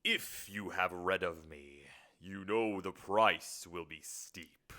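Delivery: slow, low demon voice; demon naming terms. Acted. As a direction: intone it slowly, drawing out each word. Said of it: "This will be the demon".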